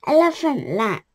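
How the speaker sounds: usual speaking voice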